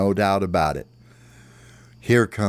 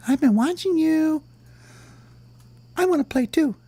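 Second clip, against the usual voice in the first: very high, eager tiny voice; little worm voice. Acted. Worm asks little bird to let him play, squeaky voice. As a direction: plead to join in, in falsetto.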